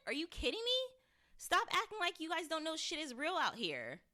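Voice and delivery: high-pitched, incredulous telling-off; exasperated scolding. Acted. Fan scolding kids who act like nothing is real, exasperated. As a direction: scold them in disbelief, pitch up.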